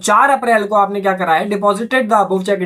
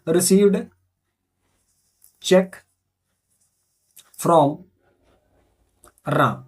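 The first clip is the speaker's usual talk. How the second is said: single words with long gaps, dropping lower; word-by-word dictation; 3 semitones lower, much slower than usual. Real, not acted. Teacher writing the narration for students, word-by-word dictation. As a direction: spell out the entry one word at a time.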